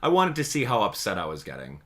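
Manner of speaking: usual speaking voice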